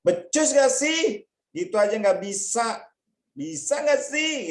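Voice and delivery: high, loud and slow, belittling; exasperated taunting bully; contemptuous scolding. Acted. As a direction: sneer at someone who can't do it. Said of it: "dia omongin begini nih".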